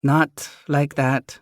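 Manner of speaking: high, slow chiding mom; chiding mother. Acted. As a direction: scold lightly, drawn out. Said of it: "Mom chides".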